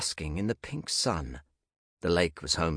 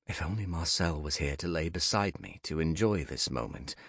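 ordinary delivery

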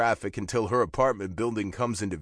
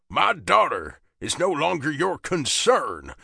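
put-on slow, higher, forceful southern accent; southern father; 6 semitones higher, 5 dB louder than usual. A narrator's reading. Southern father warning the biker off his daughter, biting.

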